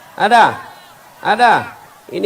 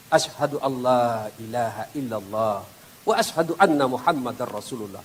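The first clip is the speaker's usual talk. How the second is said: calm recitation; 7 semitones lower, 6 dB quieter than usual. Acted. Prayer answers the angel calmly.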